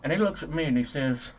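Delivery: normal talking voice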